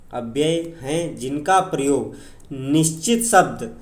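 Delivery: usual speaking voice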